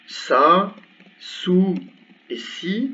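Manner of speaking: sa, su, si said clearly, each vowel distinct; arabic vowels demo. Shown as a demonstration. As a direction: sound out each short vowel. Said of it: "Pour ce qui est des voyelles". (voyelles arabes bien articulées)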